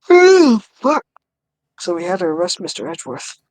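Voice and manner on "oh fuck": sudden high yelp of shock; shocked outburst. Real, not acted. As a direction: blurt it out, caught off guard. Sudden shock reading the game.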